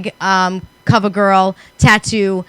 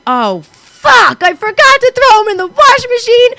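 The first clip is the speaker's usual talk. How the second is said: much higher, shocked groan of realization; suddenly remember the dirty sneaker, dismayed; 13 semitones higher, 4 dB louder than usual. Retold or imitated. Woman realizing she put the peed-on sneaker back on, shocked. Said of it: "and I went".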